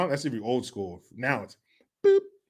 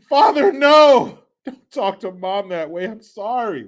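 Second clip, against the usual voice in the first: put-on pleading child; 5 dB louder, slower than usual. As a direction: wail it as a hammed-up upset kid. Child pleading during parents' quarrel.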